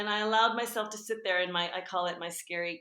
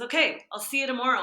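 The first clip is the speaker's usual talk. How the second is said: louder, higher, fast and chirpy; bright sister; 5 semitones higher, 7 dB louder, faster than usual. Retold or imitated. Sister calling out an eager goodbye, quick and loud. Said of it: "she goes".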